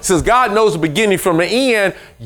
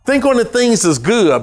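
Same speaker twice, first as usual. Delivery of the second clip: ordinary talk, nothing marked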